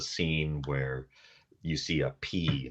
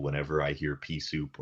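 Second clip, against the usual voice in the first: normal talking voice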